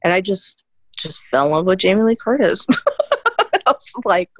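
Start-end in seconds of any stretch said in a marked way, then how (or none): 2.7-3.6 high giggle burst; high laugh. Real, not acted. Laughs at her own gush.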